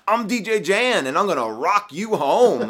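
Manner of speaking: slow hyped DJ intro; a hint of a radio DJ; lightly voiced DJ hype. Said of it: "he's like".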